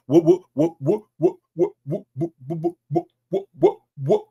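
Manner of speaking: rapid repeats climbing very high; comic stuck stammer. Pretend. Slave asking which way they're going, comically stammering. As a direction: squeak up through the stutter.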